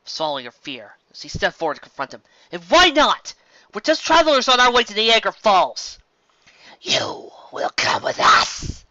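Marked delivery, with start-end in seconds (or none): 2.5-6.0 higher, louder defiant reply; bold challenge. Acted. Twilight confronts the figures. 6.8-8.8 slow, rasping creature command; a hooded beast's rasping order; menacing shout. A narrator's reading. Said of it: "the cloaked figure shouted".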